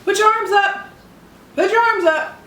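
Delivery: loud high mom yell; mom shouting. Retold or imitated. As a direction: call out like a strict mom. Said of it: "She told me".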